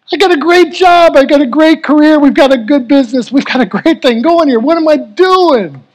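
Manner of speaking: a frightened man pouring out his doubts; reliving the panic in an anxious rush; retelling his own fear. Retold or imitated. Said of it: "I just about lost it".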